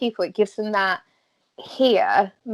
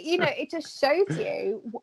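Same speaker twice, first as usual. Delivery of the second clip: normal talking voice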